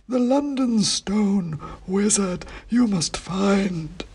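high, slow, dusty oracle voice; dusty faint ancient head. A narrator's reading. Ancient king's head answering the wizard, slow high dusty chant. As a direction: intone it like a faint, dusty myth. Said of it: "said Bran".

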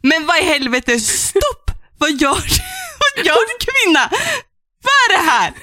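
high, incredulous yelling at the heroine; mock outraged reader. Reader scolding the heroine in high disbelief. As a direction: exclaim in disbelief at the book. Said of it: "Innan någon kommer och säger".

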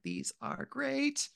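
put-on high, slow, soft awed kid voice; a toddler marveling at new slippers; delighted kid. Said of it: "They're like".

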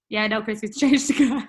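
joking to her husband about the car, laughing; laughing joke. Retold or imitated. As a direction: tease husband while laughing.

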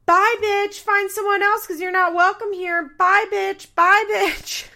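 high shrieking mock yell of bye bitch; imitating a scream. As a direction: shriek it across the room like a furious guest. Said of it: "Ashley is screaming in the background".

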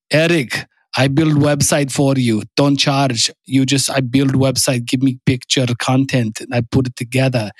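mock Russian accent with choppy, article-dropping English; imitation heavy Russian accent; fake Russian accent. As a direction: put on a German friend's accent that comes out Russian. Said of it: "That was a fake Russian accent".

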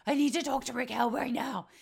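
quoting James panicking about his dog, loud demand; imitating freaking out. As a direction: play him losing it.

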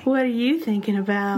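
coy lovestruck phone voice; giggly late night call. Pretend. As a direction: ask it like a smitten teen.

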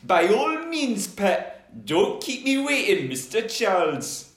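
high Geordie lilt; Geordie velociraptor. Acted. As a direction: play an impatient Geordie dinosaur. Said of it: "he might be a Geordie Velociraptor".

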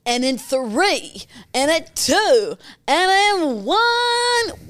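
slow, drawn-out countdown building up. Pretend. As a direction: count them in like a show host, rising at the end. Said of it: "I'll start the podcast how we started".

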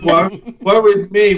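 hammed-up mock lament; dramatic sigh. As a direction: wail it like a tragic hero. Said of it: "is now just going".

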